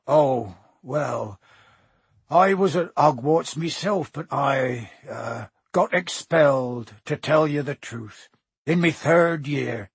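friendly giant owning up awkwardly; Hagrid, reluctantly confessing. Acted.

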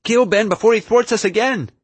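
loud, very high urgent yell; frantic high-voiced shout; shouted demand. A narrator's reading. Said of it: "Ashley shouted".

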